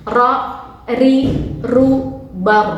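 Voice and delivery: ro, ri, ru, bar loud with a trilled r; teacher drilling the trilled r; rolled r drill. Shown as a demonstration. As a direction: roll the r clearly on each syllable. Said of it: "Jadi harus ada getaran".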